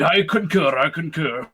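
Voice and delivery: mock-posh formal assent; posh accent. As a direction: concur like a posh gentleman. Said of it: "The poshest accent you can do".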